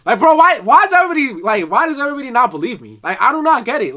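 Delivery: real frustrated outburst; exasperated. Real, not acted. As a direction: throw up your hands, why won't anyone believe me. Exasperated that nobody believes him.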